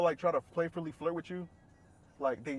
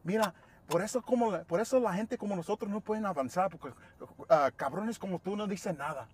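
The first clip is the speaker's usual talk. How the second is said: imitating a coworker's rant, higher-pitched; going off; 6 semitones higher than usual. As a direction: scold in a mildly heated mock tirade. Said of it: "bro, she went off".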